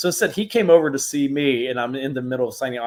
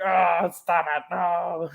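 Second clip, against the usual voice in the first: Kane Hodder protesting; 8 semitones higher, slower than usual. Retold or imitated. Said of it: "he's like a curmudgeon at times".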